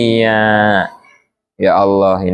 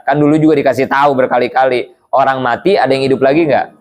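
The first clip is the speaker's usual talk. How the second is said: ordinary delivery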